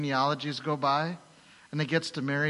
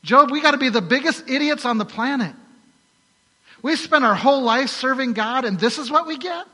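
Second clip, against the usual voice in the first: high, loud bitter rant; exasperated wife; 9 semitones higher, 8 dB louder than usual. Retold or imitated. Wife berates Job after losing everything, loud and bitter. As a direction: spit it out in frustration at her husband.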